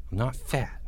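a sulky soft mutter. Acted. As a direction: mutter it down into the chest. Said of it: "Porge muttered".

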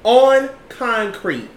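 ON CONCRETE drawn out, loud and high; "On concrete" as a slow, loud, indignant repeat; outraged emphasis. Real, not acted. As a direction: hammer "On concrete" slowly, in outrage. Repeats it in disbelief at police.

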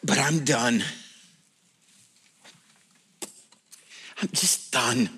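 low, subdued admission of being done; quietly giving up, low and drained; weary resignation. Acted. Friend confessing to preacher over drinks, weary resignation. Acting worn-out friend at bar.

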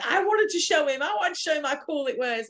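high-pitched imitation of an eager brother; mock childish pleading. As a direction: put on a high, pleading kid-like voice. Voices brother eager to show off bunker.

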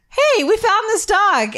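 high, drawn-out mock-innocent call; teasing kids. Friends springing a dog on a scared girl, teasing. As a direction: announce it like mischievous kids, high and slow.

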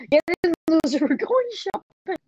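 put-on high, loud valley girl quote; mean girl voice. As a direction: play the queen bee, sneering. Quotes a movie line in a voice.

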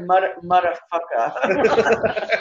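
imitated "muddafucker", slow and oddly broken up; weird cadence. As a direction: stretch out "muddafucker" with a halting restart, amused. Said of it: "He's got a very weird cadence".

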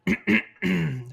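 gravelly throat clear warming up. Real, not acted. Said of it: "this is what he does all the time".

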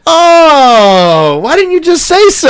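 put-on high, loud, exasperated old lady; exasperated grandma. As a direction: throw up your hands at the obvious. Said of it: "she goes".

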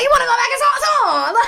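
very high, loud, fast laughing offer; giddy tease. Retold or imitated. Urging her friend to go back, giddy and laughing.

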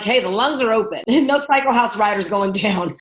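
loud, laughing pep-talk to her riders; excited rallying cry. Retold or imitated. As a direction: cheer them on, laughing as you go.

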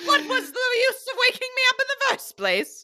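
high, fast put-on lady's voice; indignant painting. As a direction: squawk the retort in mock indignation. Acting out the painting's retort.

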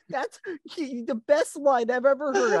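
high-pitched imitation of awed viewers; hammed-up fan amazement; mock gushing fan. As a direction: gush in a put-on high voice.

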